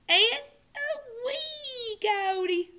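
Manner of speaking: squeaky, slow and small; high and slow. A narrator's reading. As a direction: stretch it up high and tiny. The littlest coyote.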